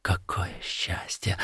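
soft whispered wonder; barely voiced, overwhelmed murmur; hushed joy. A narrator's reading. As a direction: whisper it to yourself. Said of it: "твердя лишь".

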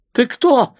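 high, loud scared shout; weak frightened cry. A narrator's reading. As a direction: cry out in fear. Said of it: "слабо крикнул один из них".

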